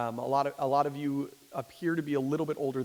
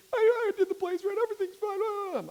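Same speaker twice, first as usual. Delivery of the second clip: put-on high, sobbing boy's voice, much higher and louder; crying linebacker; 6 dB louder than usual. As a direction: imitate the kid blubbering through tears. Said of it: "And he's crying and said".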